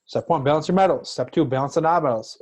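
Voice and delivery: loud recap of the steps; shouting. Real, not acted. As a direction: call out the steps like a drill. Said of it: "Sorry, I'm yelling at you with these steps".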